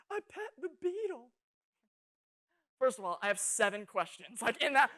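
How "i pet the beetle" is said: put-on high, tearful little-girl voice; imitated sobbing. Little sister tearfully confessing to her family, mock sobbing. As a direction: mimic a crying child getting words out. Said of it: "sobbing, little words just jumbling together".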